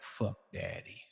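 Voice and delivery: slow, soft dismissal; retold resentful child. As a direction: imitate a fed-up kid, under the breath. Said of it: "where they like".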